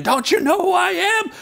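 high-pitched, indignant scream imitating an irate driver; hammed-up furious screaming; angry screaming. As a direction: scream it in mock outrage, pitch way up. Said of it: "was all angry and screaming".

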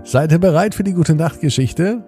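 ordinary delivery, nothing marked

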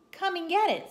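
high, loud come-and-get-it call; luring call. Acted. Hat luring the cat with a fish, luring call.

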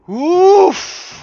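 high loud sharp exhale; relieved gasp. Real, not acted. Gamer relieved after a fight, loud high gasp. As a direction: blow out in relief.

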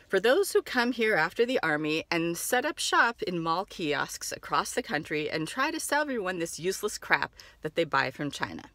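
ordinary talk, nothing marked

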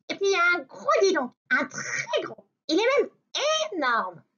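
high, quick teasing rabbit; very high cheeky bunny; little rabbit voice. Acted. (voix aiguë de petit lapin)

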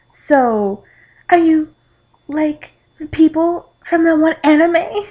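high, halting awkward question; hesitant, awkward acting. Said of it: "I said awkwardly".

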